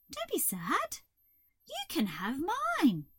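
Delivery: high, sweet caterpillar voice; comforting Hazel. A narrator's reading. Hazel offering the toad her green, comforting.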